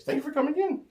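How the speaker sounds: squeaky high-pitched voice; pitch jumps way up. Real, not acted. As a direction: voice suddenly shoots up high. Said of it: "I just went a little weird there, my pitch".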